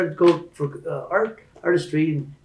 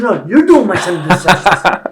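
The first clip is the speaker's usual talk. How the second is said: raised, heated voice with a laugh; indignant retort; 3 semitones higher, 11 dB louder than usual. Retold or imitated. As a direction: push back hard on the counselor. Retold heated retort to counselor.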